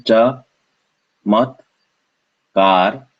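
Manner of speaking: "cha, mat, kar" as three separate beats; syllable by syllable. Shown as a demonstration. Teacher showing the first split, "cha, mat, kar" syllable by syllable. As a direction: pause after "cha" and "mat". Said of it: "so this is the first one".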